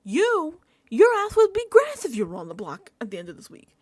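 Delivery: loud, high mock outburst; mock yelling at Rachel about nominations; mock angry Kelly. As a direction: bark it like an indignant houseguest. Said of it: "She's like".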